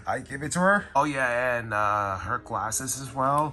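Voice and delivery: loud, high cheerful narration; host it with energy; upbeat vlog host. Acted. Upbeat morning vlog narration over music.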